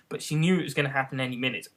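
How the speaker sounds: plain reading voice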